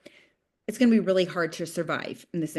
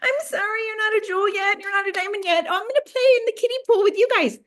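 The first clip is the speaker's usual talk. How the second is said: much higher, small, apologetic put-on voice; mock meek apology; 11 semitones higher, faster than usual. Mocking her old self playing small, high and meek. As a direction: shrink yourself, mockingly timid. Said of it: "play small and be like".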